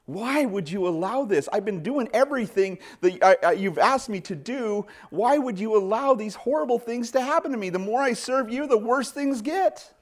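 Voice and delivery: a believer venting at God, voice climbing; frustrated cry to God. Retold or imitated.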